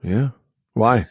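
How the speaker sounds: low-key, reluctant reply; unenthusiastic. Real, not acted. Under-the-weather guest answers his co-host, unenthusiastic. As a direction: answer with barely any interest. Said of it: "could not have said that with less enthusiasm".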